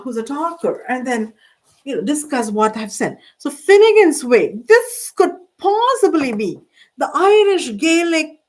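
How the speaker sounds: normal talking voice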